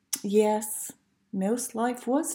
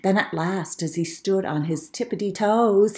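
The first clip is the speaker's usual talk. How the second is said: plain reading voice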